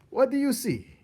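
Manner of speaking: high, loud teasing question; teasing rat. Acted. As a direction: tease him with the question. Botticelli asks.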